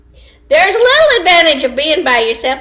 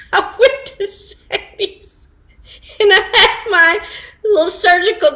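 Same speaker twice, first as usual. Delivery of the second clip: laughing; 9 semitones higher than usual. Real, not acted. Laughing while telling gum story.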